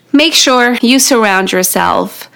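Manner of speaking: ordinary talk, nothing marked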